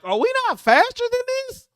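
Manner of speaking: high-pitched incredulous rhetorical question; exasperated disbelief. Retold or imitated. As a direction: act out your own stunned question on the sideline. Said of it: "I'm like".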